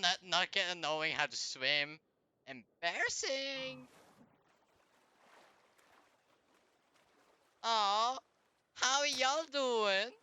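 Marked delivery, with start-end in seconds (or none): 2.5-10.1 higher, slow, drawn-out teasing; sarcastic slow taunt; mocking drawl. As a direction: stretch every word to rub it in. Mocking drowned enemy.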